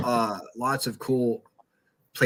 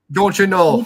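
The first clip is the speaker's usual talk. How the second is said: loud, slow, hammed-up Minnesota accent; mock Minnesota accent; 5 semitones higher, 7 dB louder than usual. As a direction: stretch out "Don't you know?" in a joking Minnesota drawl. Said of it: "talk like that".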